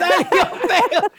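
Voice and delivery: high, slow, drawn-out tease; teasing drawl. Acted. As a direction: stretch it out to mock her. Teasing a friend's comeback.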